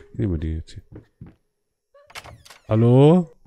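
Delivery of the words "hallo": calling out. Real, not acted. Calling out into dark house.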